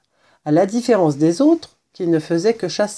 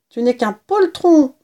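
higher-pitched sibling jibe; taunting; 6 semitones higher, 4 dB louder than usual. A narrator's reading. Sister calls her brother a coward, taunting. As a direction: tease with a lifted, pointed voice.

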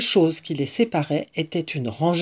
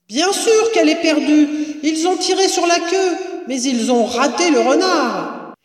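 high, projected retort; calling down; 10 semitones higher than usual. A narrator's reading. Mistress sets woman below straight, calling down. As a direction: shout the answer down the stairs.

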